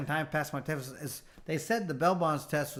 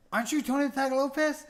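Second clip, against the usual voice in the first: starstruck question; 10 semitones higher, 4 dB louder than usual. Retold or imitated. Fan recognizes him, voiced.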